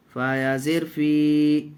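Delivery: ordinary delivery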